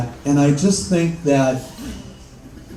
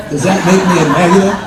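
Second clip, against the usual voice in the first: father's voice; 6 semitones higher, 9 dB louder, faster than usual. Retold or imitated. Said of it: "he goes".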